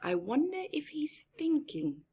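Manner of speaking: soft whispered wonder; whispering a question to the kids; curious whisper. A narrator's reading. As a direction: lean in and wonder aloud. Aside to children.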